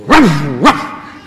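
imitating a barking dog; mock bark like an angry dog; loud playful woof. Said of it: "when someone barks at you".

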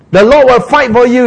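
high, loud proclamation; confident Moses. Acted. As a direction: declare it with full assurance.

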